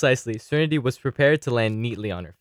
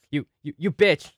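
higher, stuttering outburst; stammering insult; 6 semitones higher than usual. Acted. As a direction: sputter it out in fury.

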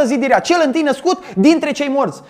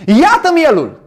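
proclaiming; 3 semitones higher, 4 dB louder, slower than usual. Acted. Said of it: "Și Ioan strigă".

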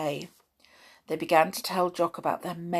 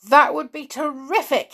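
high, loud, delighted exclamation; thrilled gratitude; 9 semitones higher, 6 dB louder than usual. A narrator's reading. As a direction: burst with boyish joy. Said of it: "as if he could hug Julian".

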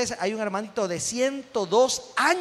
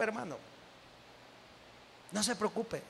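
softly repeated reassurance; 15 dB quieter than usual. Real, not acted.

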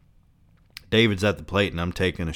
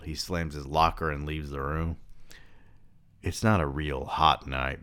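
plain reading voice

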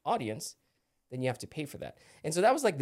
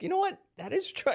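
much higher, sudden eureka; mock aha moment, pitched way up; surprised realization. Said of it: "And I'm like".

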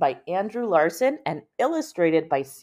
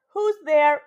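character voice; 10 semitones higher, 6 dB louder than usual. Acted. Grandpa voiced at the door.

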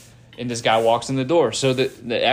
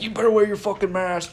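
mocking bossy quote; 10 semitones higher than usual. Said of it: "They're like".

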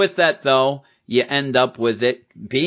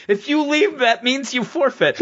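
high, petulant taunt; whiny Deadpool; 12 semitones higher than usual. Retold or imitated. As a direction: whine it like a sore winner. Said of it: "He's like".

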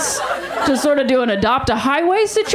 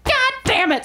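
exasperated; 10 semitones higher than usual. Retold or imitated. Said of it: "And I was like".

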